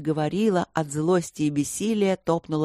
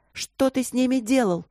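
higher-pitched, threatening demand; indignant daughter wanting an answer; menacing accusation; 7 semitones higher than usual. A narrator's reading. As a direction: interrogate your guilty father. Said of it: "угрожающе склонила голову Эбби".

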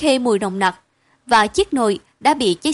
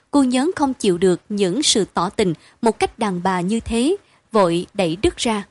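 ordinary delivery, nothing marked

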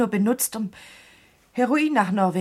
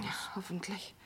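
softer, whispered and resigned; whispered hope; 3 semitones lower, 16 dB quieter than usual. Acted. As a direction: barely voice the hope. Despondent reply in the crisis.

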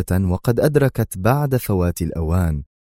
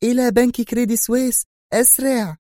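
much higher woman's voice urging the driver; eager high command; urgent call. A narrator's reading. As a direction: hurry the cabbie on, breathless. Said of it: "وهتفت بقائدها".

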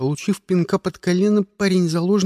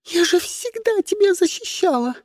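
very high, pleading squeal; shrieking. A narrator's reading. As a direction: whine it out in a frightened squeak. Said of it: "заверещала девчонка".

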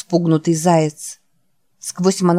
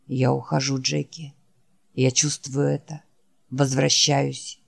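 low, soft, worn-out creature voice; barely has the strength to say the name; weary dying Wolf; 4 semitones lower, 6 dB quieter than usual. Acted. Wounded Wolf calling his friend Jack, low and faint. Wolf's voice.

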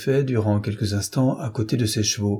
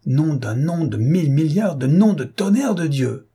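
loud, raised furious swearing; a coachman's outburst of cursing; angry oath; 6 semitones higher, 3 dB louder than usual. A narrator's reading. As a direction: spit out the curse at the horse. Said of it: "s'écria Jean-Pierre".

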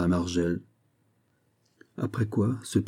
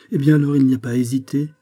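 brisk; 5 semitones higher, 5 dB louder, faster than usual. A narrator's reading. Nurse decides briskly.

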